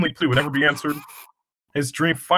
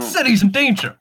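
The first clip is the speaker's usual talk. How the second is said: dramatic hero; 10 semitones higher, 5 dB louder than usual. Acted. Dramatic superhero alarm line.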